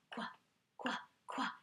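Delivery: soft quack quack; whispered quack. Shown as a demonstration. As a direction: quack softly like a little duck. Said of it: "It sounds like quack".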